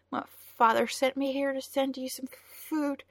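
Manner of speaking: put-on high, trembling girl's voice; a frightened girl struggling to explain. A narrator's reading. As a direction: voice quivering with fear. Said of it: "Her voice was high and trembling".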